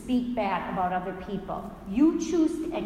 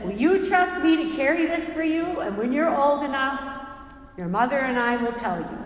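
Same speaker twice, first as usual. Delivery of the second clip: gentle father, higher and louder; 4 semitones higher, 5 dB louder than usual. Retold or imitated. Father voiced in story.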